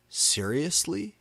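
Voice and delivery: high, slow amazed gasp. A narrator's reading. Satan gasping at the vampire reveal, high amazed voice. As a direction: gasp the word in disbelief. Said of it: "he gasped".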